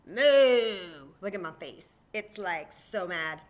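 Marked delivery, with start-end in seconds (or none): 0.1-1.1 very loud, mad moo; angry cow. Acted. Teacher acting out an angry cow, loud cross moo. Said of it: "Yeah, angry cow".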